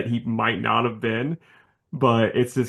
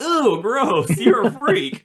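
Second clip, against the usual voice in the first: high, loud mock disgust; high-pitched mock rejection, voice raised; disgusted Kitty; 11 semitones higher, 5 dB louder, much slower than usual. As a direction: recoil in hammed-up revulsion. Retelling Kitty's disgusted rebuff.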